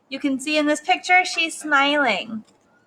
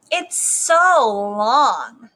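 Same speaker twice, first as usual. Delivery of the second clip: very slow, drawn-out taunt; long, dragged-out complaint; mocking classmate; 4 dB louder, much slower than usual. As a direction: stretch it out to make fun.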